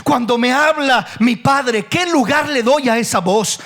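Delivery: ordinary talk, nothing marked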